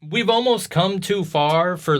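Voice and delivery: normal talking voice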